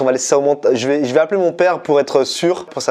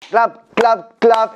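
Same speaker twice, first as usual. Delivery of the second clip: shouted clap sounds for syncing; clapperboard claps; 8 semitones higher, much slower than usual. Shown as a demonstration. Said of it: "synchroniser le son et l'image".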